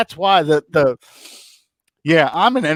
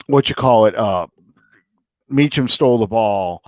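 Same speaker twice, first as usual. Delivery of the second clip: usual speaking voice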